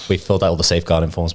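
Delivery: normal talking voice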